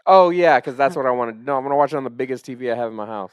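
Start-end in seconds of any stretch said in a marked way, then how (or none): 0.1-1.3 loud, high mock agreement; sarcastic outburst. Real, not acted. Podcaster scoffing at watching on a phone, loud sarcasm.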